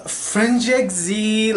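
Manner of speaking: put-on slow, drawn-out French accent on the title; mock French accent. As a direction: say it the French way, slowly. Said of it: "she put on, like, a French accent".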